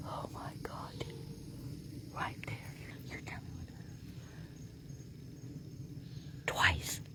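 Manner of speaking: urgent breathy whisper in the dark. Real, not acted. Investigator whispering to Dale after the call, urgent whisper. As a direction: hush it, afraid to scare it off. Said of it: "I'm being quiet because I heard".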